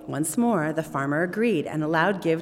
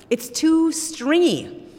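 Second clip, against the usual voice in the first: whiny complaint; 12 semitones higher, 8 dB louder, much slower than usual. Acted. Farmer complains about soup.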